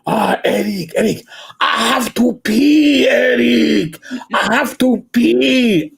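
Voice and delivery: put-on high, drawn-out French-accented whine; imitating an urgent Frenchman, vowels stretched. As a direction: plead comically in a French accent. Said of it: "I worked with a Frenchman".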